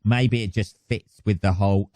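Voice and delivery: usual speaking voice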